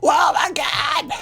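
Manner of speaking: long, high, drawn-out scream; mock fan freak-out. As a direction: imitate hyped fans screaming in amazement. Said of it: "people are like".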